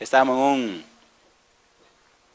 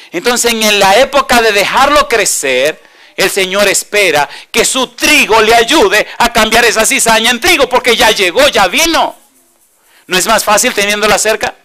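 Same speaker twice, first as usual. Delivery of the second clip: higher, louder, ringing sermon voice; preaching at full force; 8 semitones higher, 10 dB louder, slower than usual. Acted. Preacher driving home the parable to the congregation, full force. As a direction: drive the parable home to the crowd.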